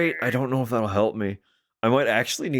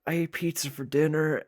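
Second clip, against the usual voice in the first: ordinary delivery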